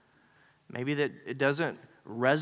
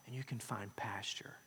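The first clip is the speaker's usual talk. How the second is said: whisper; 5 semitones lower, 16 dB quieter than usual. Acted. Quoting Jesus, hushed.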